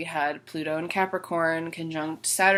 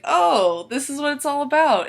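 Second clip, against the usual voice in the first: louder, higher "aha" retelling; sudden realization; 8 semitones higher, 7 dB louder than usual. Retold or imitated. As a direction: act out the penny dropping, voice lifting. Retelling her moment of sudden realization.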